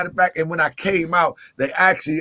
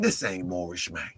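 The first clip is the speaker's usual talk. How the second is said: low, hushed rebuke; 13 semitones lower, 12 dB quieter than usual. Retold or imitated. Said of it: "this how I said it to him".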